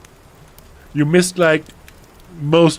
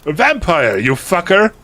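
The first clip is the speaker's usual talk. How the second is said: higher, louder mock-angry outburst; angry retort; 9 semitones higher, 7 dB louder than usual. A narrator's reading. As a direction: spit it out as if slapping someone. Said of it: "I said, slapping him".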